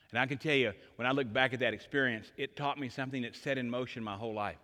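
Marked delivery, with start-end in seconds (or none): none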